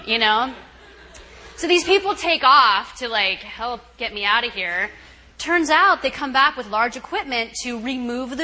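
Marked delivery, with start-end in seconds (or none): none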